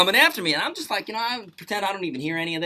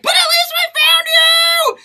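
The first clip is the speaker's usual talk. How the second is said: much higher, loud, slow cheerful cry; cheerful shout; 10 dB louder, much slower than usual. Retold or imitated. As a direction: sing it out as cheerful consolation. Friend cheerfully consoles him.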